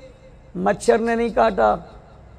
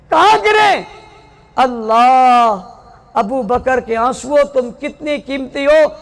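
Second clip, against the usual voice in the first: impassioned preaching; 2 semitones higher than usual. Acted. Preacher crying out to mothers and sisters, impassioned preaching. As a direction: preach it out to the crowd, voice soaring.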